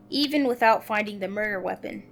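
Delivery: ordinary talk, nothing marked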